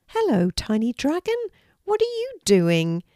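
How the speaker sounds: lightly lifted, curious question; lightly voiced friendly girl. A narrator's reading. Kelty greets the tiny dragon, lightly voiced friendly girl.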